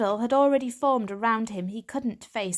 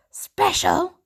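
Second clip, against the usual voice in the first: incredulous sputter, higher and louder; spluttering; 10 semitones higher, 7 dB louder than usual. Acted. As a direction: spit the word out in disbelief. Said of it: "she spluttered".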